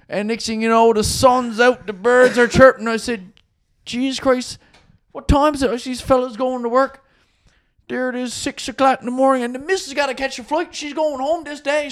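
high-pitched acted storyteller's urgency; worked-up retelling. Man telling of dawn in the alley before a flight, acted agitation. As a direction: imitate a man wound up in his yarn, pitched well up. Acting the man's escalating alley tale.

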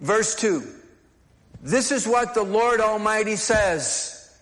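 ordinary delivery

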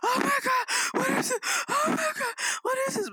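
put-on very high, panicky child squeal; squeaky mock panic; horrified kids. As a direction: imitate a child freaking out in horror. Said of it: "seem like they're scarred for life".

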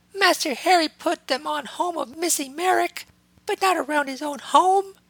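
very high reproachful elf voice; scolding house elf. A narrator's reading. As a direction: scold him like a tiny butler. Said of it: "the house elf's scolding tone".

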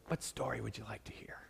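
imitating grandpa's raspy whisper, quick. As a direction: whisper it like an old grandfather at bedtime. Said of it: "his voice would lower to a raspy whisper".

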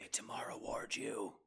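breathy joking whisper. Pretend. Cohost whispers a joke about the tricky film score, whispering. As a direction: hush it like a secret punchline.

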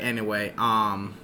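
ordinary delivery

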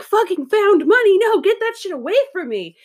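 high, loud exclamation of disgust; mock outburst. Telling customers in her salon, loud mock refusal. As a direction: push the money away, loud and high. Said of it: "So I was like".

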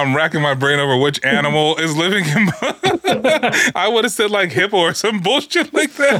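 talking through laughter, high; giggling while talking; laughing. Real, not acted. Laughing at the quiz answer.

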